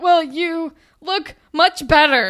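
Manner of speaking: loud, high, slow emphatic delivery; mock backhanded compliment. Peter compliments Claire clumsily, hammed-up imitation. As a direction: exaggerate the jerk's drawn-out praise.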